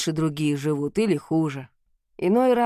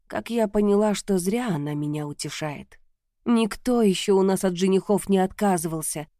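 plain reading voice

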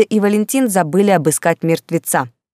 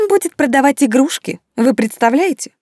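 high-pitched, incredulous derision; disdainful, voice lifted high; scornful; 9 semitones higher, 3 dB louder than usual. A narrator's reading. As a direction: scoff in scandalised disbelief. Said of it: "фыркнула баронесса".

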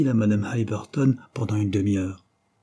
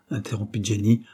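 plain reading voice

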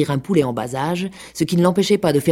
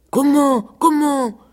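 high, louder startled cry; alarmed pig; 11 semitones higher, 5 dB louder, slower than usual. A narrator's reading. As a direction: snap back in alarm.

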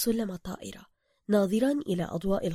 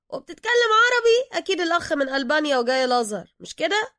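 pushy Cairo driver; 8 semitones higher, 7 dB louder than usual. Acted. Driver character voice.

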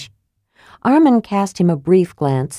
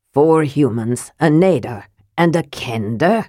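a dwarf lord sneering at the newcomers; mild disgust on Kender; distaste; 3 semitones lower than usual. Acted. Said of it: "as though it tasted bad".

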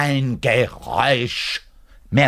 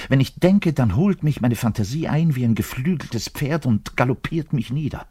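carried away; 5 semitones higher than usual. Acted. Bass player gets carried away describing his fantasy. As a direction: let the fantasy gallop off with you.

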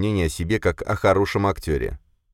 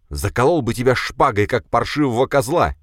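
angry threat; 7 semitones higher, 4 dB louder than usual. A narrator's reading. Boris threatens while backing off.

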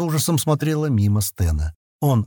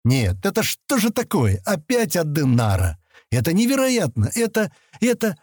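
higher, incredulous outburst; exasperated disbelief; 7 semitones higher than usual. A narrator's reading. Sten swearing at the coincidence of names, exasperated. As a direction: build to high, spluttering disbelief.

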